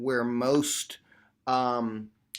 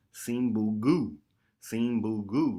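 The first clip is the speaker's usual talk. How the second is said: sample syllables; 3 semitones lower, slower than usual. Shown as a demonstration. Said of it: "three syllables".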